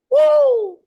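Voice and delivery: loud, high party whoop; cheering woo. Real, not acted. Caller whooping to the hosts, loud. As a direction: whoop it up for the show.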